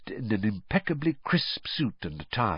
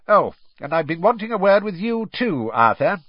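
high-pitched, emphatic official; brisk Crouch; 10 semitones higher, 5 dB louder than usual. Acted. Crouch turning to Arthur Weasley, briskly. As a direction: buttonhole him in a brisk official voice.

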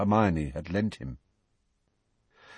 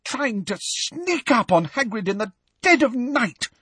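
high-pitched, seething indignation; appalled fury in a much higher voice; choked with rage; 13 semitones higher than usual. A narrator's reading. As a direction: force the words out, outraged. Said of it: "having difficulty talking due to rage".

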